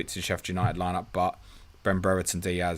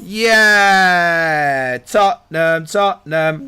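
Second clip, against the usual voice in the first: loud, high football chant; cheering; 8 semitones higher, 10 dB louder, much slower than usual. Real, not acted. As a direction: cheer your little one on. Cheering along with his child.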